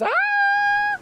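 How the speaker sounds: sudden high squeal of surprise; surprised shriek. Real, not acted. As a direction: yelp in surprise. Sudden high shout at raid.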